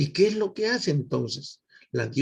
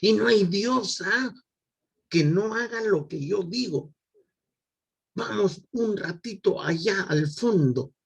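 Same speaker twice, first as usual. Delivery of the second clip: Zeus indignado, mild; 5 semitones higher than usual. Acted. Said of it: "indignado, imponiendo su jerarquía".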